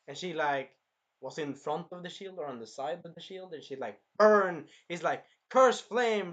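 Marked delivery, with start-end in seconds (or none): 4.2-4.7 loud, high imitated battle call; mock attack cry. Acting out the flame attack. 5.5-6.3 loud, high spell shout; mock attack call. Acting out the flame attack.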